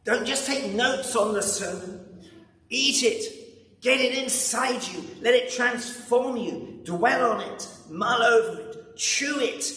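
high, urgent pleading command; urgent angel. Acted. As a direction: press it on him like a fervent messenger. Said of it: "the angel gives it to John and says".

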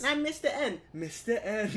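put-on Brooklyn accent. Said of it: "Brooklyn accent of Harley Quinn".

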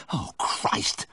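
horrified, breathless exclamation; gasped. A narrator's reading. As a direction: gasp the words out on the breath. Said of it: "I gasped".

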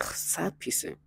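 whispered under the breath, slow; retold insult, hushed and trailing off; muttered insult. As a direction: mutter it in a near-silent whisper. Muttered retold insult, cut short.

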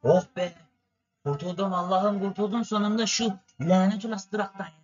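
relieved sigh. Real, not acted. Relief after leaving the stop.

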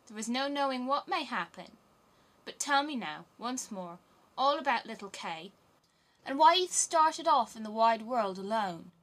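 lightly voiced bossy robber girl; a hint of an eager, commanding girl; robber maiden. A narrator's reading. Robber maiden questions Gerda.

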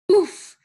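loud, high "oof"; acted grunt of being landed on; impact grunt. A narrator's reading. Mercy leaps at Mister Watson, impact grunt. Pig leaps onto the driver.

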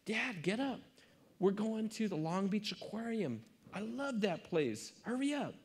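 a lightly voiced excited kid; excited little daughter. Daughter urging her dad to get up for the aquarium, lightly voiced eager child.

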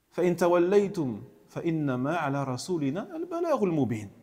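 usual speaking voice

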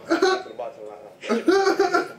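high, loud and slow through laughter; loud laughing line. Real, not acted. Laughing at the trick.

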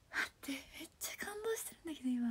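fast amazed whisper; awed whisper. Real, not acted. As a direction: whisper in disbelief.

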